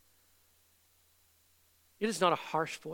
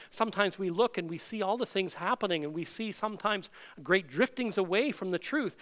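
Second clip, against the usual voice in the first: ordinary talk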